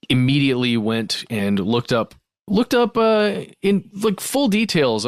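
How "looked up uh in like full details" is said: sudden high-pitched disbelief; incredulous. Real, not acted. Host outraged at the lieutenant's snooping, jumps up. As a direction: voice jumps up in shock.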